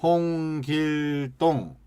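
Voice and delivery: 홍길동 with every syllable equally weighted, slowly; even stress. Shown as a demonstration. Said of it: "홍자 세게 안 읽고". (홍길동을 강세 없이 똑같이)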